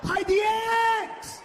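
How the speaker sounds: high, slow, shouted order; scheming shout. Pretend. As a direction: bellow the plan at the crowd. Second take, shouted.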